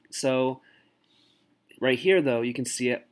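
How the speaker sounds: ordinary talk, nothing marked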